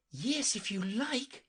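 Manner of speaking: put-on high, slow girlish reply; young girl Alice. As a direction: answer lightly as a little girl. Alice answers the unicorn.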